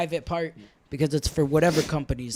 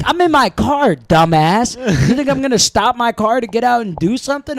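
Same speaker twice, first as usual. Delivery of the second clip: much higher, loud scornful yell; retold shout; 7 semitones higher, 8 dB louder than usual. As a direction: yell it like he's an idiot.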